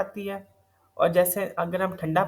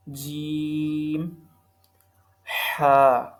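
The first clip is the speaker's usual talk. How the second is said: each letter called out slowly and firmly, with pauses between; tajweed teacher reciting the alphabet; alphabet recitation; 2 semitones lower, 3 dB louder, much slower than usual. Shown as a demonstration. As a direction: recite the letters one by one for pupils to copy.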